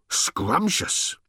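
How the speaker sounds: high gasp, then a savoured word; gasp, then taste the word; delighted gasp. A narrator's reading. Little robot gasping with pleasure to Ford.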